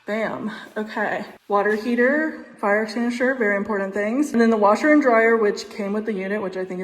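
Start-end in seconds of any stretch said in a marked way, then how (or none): none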